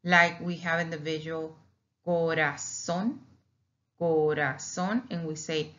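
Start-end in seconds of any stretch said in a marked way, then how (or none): 2.1-5.0 corazón said slowly with one light tap on the r; single R. Shown as a demonstration. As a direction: tap the r once in corazón, unhurried. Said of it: "that's the single pronunciation of one only".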